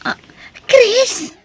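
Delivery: high, delighted gasp of his name; surprised. Acted. Girlfriend hearing her boyfriend on the phone, surprised and delighted. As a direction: she can't believe it's him calling.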